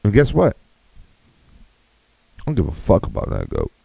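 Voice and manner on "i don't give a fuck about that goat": deep mock voice. Deadpan dismissal, dropped low.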